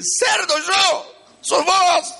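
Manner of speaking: very high, offended yell; indignant shout back. Retold or imitated. Offended driver yelling back at the warner, high indignant shout. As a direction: hurl the insult straight back.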